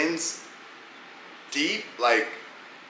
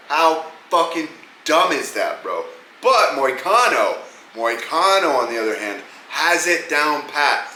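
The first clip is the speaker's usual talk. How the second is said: ordinary delivery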